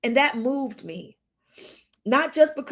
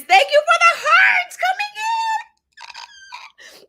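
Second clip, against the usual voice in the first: excited squeal; 7 dB louder than usual. Real, not acted. Host thanking viewers for hearts, squealing. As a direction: squeal your thanks at the top of your voice.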